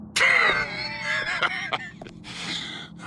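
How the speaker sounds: high, shrieking maniacal cackle; unhinged laugh. Acted. Broken man mocking his rival, manic shrieking laugh. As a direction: let the madness spill out in a howling laugh.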